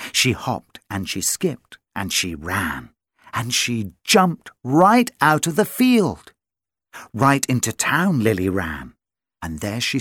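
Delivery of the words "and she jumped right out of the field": ordinary delivery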